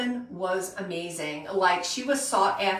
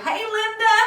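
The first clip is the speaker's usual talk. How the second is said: high, eager greeting; bright welcome; 12 semitones higher, 4 dB louder than usual. Real, not acted. Host greeting a viewer joining her live stream, high and eager. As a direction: call out a happy hello.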